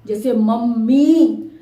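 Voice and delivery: मम्मी said slowly with the final मी stretched; teacher stretching मम्मी to show the long ई; long ई demo. Shown as a demonstration. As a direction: hold the मी in मम्मी long for the class. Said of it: "ई की आवाज का समय लगा".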